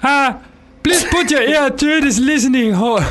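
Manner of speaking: high comic impression voice. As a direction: ham up a high character voice with a noisy lead-in. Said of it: "when you do impressions of people".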